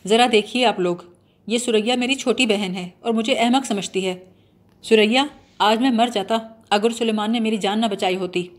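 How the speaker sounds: ordinary delivery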